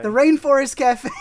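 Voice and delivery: loud corrected name, laughing. Real, not acted. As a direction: blurt it out with a laugh. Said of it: "Great job".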